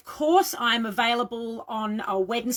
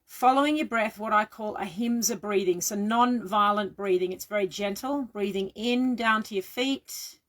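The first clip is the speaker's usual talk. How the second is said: guided meditation. Real, not acted. Leads guided breathing meditation.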